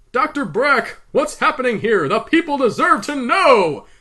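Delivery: loud, high, urgent shout. A narrator's reading. Man demanding answers from Doctor Brack, shouting. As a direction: shout it out, drawing out the last words. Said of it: "he shouted".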